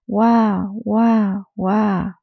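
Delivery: lightly voiced baby crying; baby crying wah. A narrator's reading. Narrator mildly voicing a crying baby. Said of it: "she cried".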